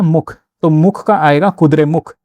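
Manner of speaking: मुख said slowly and pressed each time; stress on मुख. Shown as a demonstration. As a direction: lean on मुख as the hook. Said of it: "मुखर का आपको क्या याद रखना है?". (मुख पर ज़ोर, धीमी गति से)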